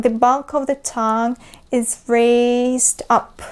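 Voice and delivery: ordinary talk, nothing marked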